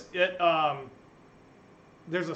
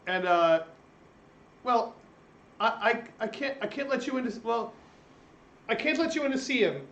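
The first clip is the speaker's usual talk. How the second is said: higher, fast, stammering servant voice; nervous servant; 7 semitones higher, 5 dB quieter than usual. Acted. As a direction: stutter it out, flustered and anxious.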